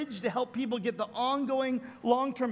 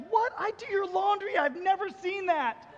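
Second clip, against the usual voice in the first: high-pitched imitation of disbelief; astonished wife; 7 semitones higher than usual. Wife to husband about the hidden bullet, astonished. Wife's astonished reply acted out.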